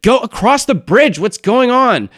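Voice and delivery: high, loud yelled order; exasperated yell. Acted. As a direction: yell across at a rowdy crowd. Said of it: "She yells at the bullywugs".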